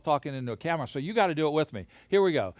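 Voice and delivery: ordinary delivery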